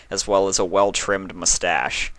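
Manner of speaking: ordinary delivery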